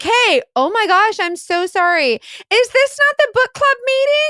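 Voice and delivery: high, breathless fake apology; mock oblivious intruder. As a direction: barge in pretending to be lost. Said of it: "be like".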